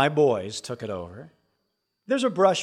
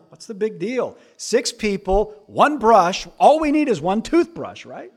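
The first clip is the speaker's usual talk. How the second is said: mock dad; 6 semitones higher, 7 dB louder than usual. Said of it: "I'm going".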